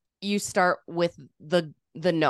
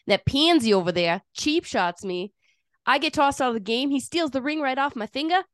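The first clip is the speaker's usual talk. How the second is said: higher-pitched, hammed-up Boston hockey player; Jack O'Callahan; 6 semitones higher than usual. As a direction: act out a movie scene in thick Boston accent. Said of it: "I'm transforming into Jack O'Callahan".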